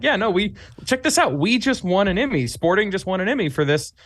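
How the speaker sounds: can't contain the good news; excited, high-pitched. Retold or imitated. Showing wife the Emmy win.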